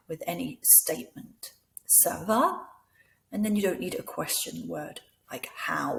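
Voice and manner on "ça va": "Ça va" climbing steeply at the end; voice going up. Shown as a demonstration. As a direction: turn "Ça va" into a question by raising "va". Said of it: "make your voice go up".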